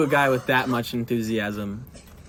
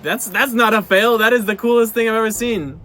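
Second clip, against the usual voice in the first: loud, high hype; amazed and pumped up; excited; 6 semitones higher, 5 dB louder than usual. Real, not acted. Hyped by cool stunt.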